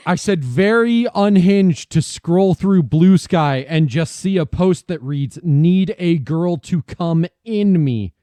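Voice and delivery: loud, slow, every word clear. Real, not acted. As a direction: say it again slowly and loudly. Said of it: "What the fuck did you say?".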